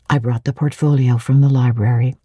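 ordinary delivery, nothing marked

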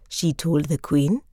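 usual speaking voice

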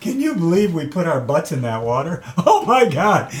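high, laughing "can you believe it"; laughing disbelief. Retold or imitated. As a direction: laugh through the memory of the cold swim. Laughing about the cold water.

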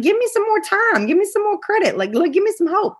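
high, pleading imitation of the doctor; mock pleading doctor. Doctor pleading for more time.

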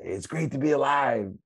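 high, loud rally cry reenacted; retold team rallying yell. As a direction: yell it like a team captain firing up exhausted players. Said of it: "And I'd scream".

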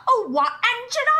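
high, hammed-up fake Cockney accent; mock a put-on Cockney greeting. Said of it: "his fake Cockney accent".

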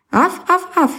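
high yapping barks; puppy barking. Acted. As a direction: bark like a puppy. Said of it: "заорал щенок".

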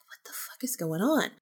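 hushed, breathless whisper of disbelief; whispered bewilderment. Retold or imitated. Feeling a sudden burst of energy, a stunned whisper. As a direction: whisper it like you can't believe it.

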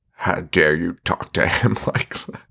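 low, hammed-up scolding, breaking into a laugh; mock indignation. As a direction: imitate an offended parent, then crack up. Acted parent's indignant quote.

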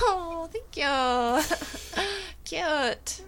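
high, slow, gushing flattered thanks; Jonathan from Queer Eye. Pretend. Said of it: "me pretending to sound like Jonathan from Queer Eye".

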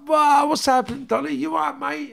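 put-on overjoyed hello to an old mate; happy greeting. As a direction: greet him like you're thrilled to see him. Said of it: "I pulled up all happy to see him".

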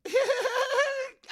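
high, loud burst of giggles; giggling. Acted. Kid tickled by the poop line, high loud giggle. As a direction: giggle loudly at your own quote. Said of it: "I'm giggling just thinking about it".

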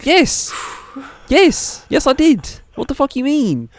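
high, loud indignant yeses; mock outrage. Asked if he snitched on kids, answers high and loud. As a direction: shout it up high, offended.

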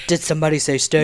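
low husky sultry voice; sexy voice. Retold or imitated. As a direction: purr it like a sultry radio host. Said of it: "my sexy voice".

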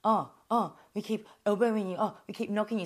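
imitating sarcastic fake concern; mock them with exaggerated oh's; jeering, put-on retold voice; mocking taunt. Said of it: "So they're giving me shit".

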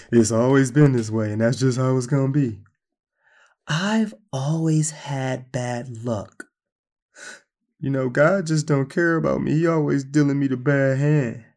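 lightly voiced hopeless complainer; a touch of a bitter, defeated believer; doubting believer voice. A hint of a churchgoer sulking about his bad luck. Voicing people who doubt God.

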